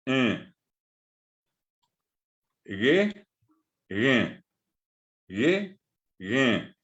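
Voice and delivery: a, un, se, e, ge said slowly and apart with long pauses; pronunciation drill. Shown as a demonstration. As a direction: model each Guaraní sound for the class to repeat. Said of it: "para recordarles entonces la pronunciación". (sonidos del guaraní pronunciados uno a uno, con pausas largas)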